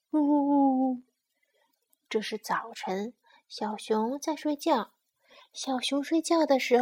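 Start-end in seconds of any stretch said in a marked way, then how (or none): 0.1-1.0 acted sympathetic boo-hoo; mock sobbing. Sparrow feels sorry for little bear, mock crying. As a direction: voice a sparrow's pretend wailing.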